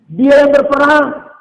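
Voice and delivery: loud, high, slow declaration; emphatic proclamation. Real, not acted. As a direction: proclaim it forcefully, drawing it out. Repeated emphatically for the congregation. (seruan lantang, tinggi dan lambat)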